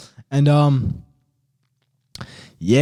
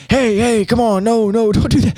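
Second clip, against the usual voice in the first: higher, pleading call, imitated; mock urgent call in a raised voice; hiker warding off bear; 7 semitones higher than usual. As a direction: imitate someone fending off an animal.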